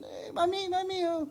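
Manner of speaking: put-on high small child's voice calling mami; a little child tugging at mommy, unaware. Child at the lion cage calling to mother, high childlike voice.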